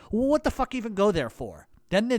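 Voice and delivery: normal talking voice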